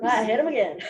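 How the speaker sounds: usual speaking voice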